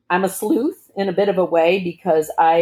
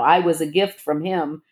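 usual speaking voice

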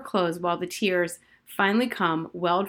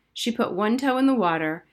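usual speaking voice

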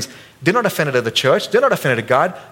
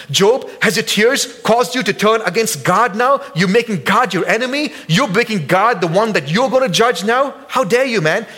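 rebuking Job; 6 semitones higher than usual. Acted. Elihu rebuking Job for turning against God. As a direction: call him out, how dare you.

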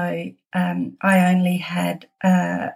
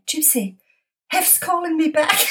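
excited; 9 semitones higher than usual. Retold or imitated. Phone rings from Beverly Hills.

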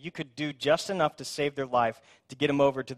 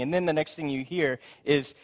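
usual speaking voice

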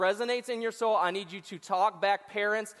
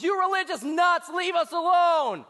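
higher, louder shout from a passing car; angry yell; 9 semitones higher, 5 dB louder than usual. Acted. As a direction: bellow it out the car window. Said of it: "and he yells".

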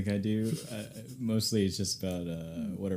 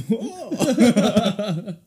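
laughing; 12 semitones higher, 13 dB louder than usual. Real, not acted. Laughing at Albany mix-up.

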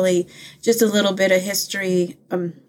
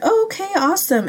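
much higher, quick bright imitation of the ladies; chirpy ladies; 12 semitones higher, faster than usual. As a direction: mimic their eager, upbeat reply fast. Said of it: "they were like".

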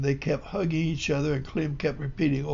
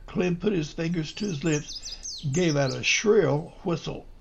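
ordinary delivery, nothing marked

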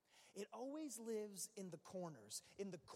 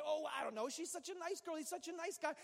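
naive gushing; 7 semitones higher, 4 dB louder than usual. Retold or imitated. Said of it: "you say".

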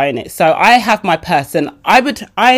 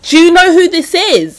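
incredulous; 11 semitones higher, 6 dB louder than usual. Retold or imitated. Friend Mike incredulous in retelling.

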